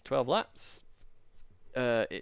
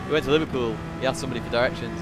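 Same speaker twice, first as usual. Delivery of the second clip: usual speaking voice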